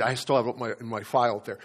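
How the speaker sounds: ordinary talk, nothing marked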